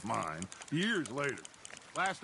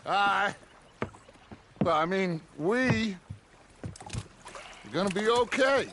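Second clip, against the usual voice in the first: loud, raised, halting declaration; calling out; 4 semitones higher, 4 dB louder than usual. Acted. Speaking to "you two by my side", calling out. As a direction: project it, phrase by phrase.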